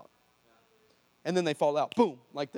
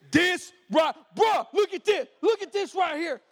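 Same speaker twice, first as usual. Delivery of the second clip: loud, high bro-style amazement, imitated; excited disbelief; 11 semitones higher, 8 dB louder, slower than usual. Football player shows off his healed arm, acted excited shouting.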